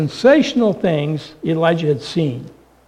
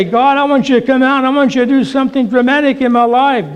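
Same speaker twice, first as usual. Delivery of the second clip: demanding; 6 semitones higher, faster than usual. Pretend. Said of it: "We say".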